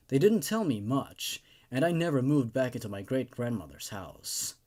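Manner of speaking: low, measured storyteller's voice reading a horror tale; creepypasta narration. A narrator's reading. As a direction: read it darkly, keeping the listener uneasy.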